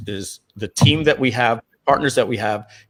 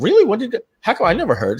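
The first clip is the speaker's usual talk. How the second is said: mock surprise; 10 semitones higher, faster than usual. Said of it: "people would say".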